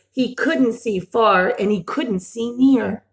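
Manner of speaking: ordinary delivery, nothing marked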